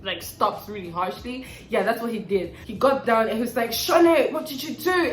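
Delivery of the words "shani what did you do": high mock-appalled exclamation; shocked outcry. Boss confronting her after stopping the car, shocked outcry. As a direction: imitate an aghast boss, pitched up.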